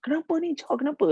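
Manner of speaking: high anxious asking; worried grandmother. Retold or imitated. Grandmother asks what happened, high and worried. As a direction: ask what's wrong, high and fretful. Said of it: "dia cakap".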